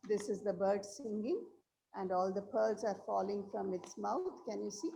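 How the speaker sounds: very faint and far from the mic; speaking so softly it's hard to hear; barely audible. Real, not acted. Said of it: "your voice is low".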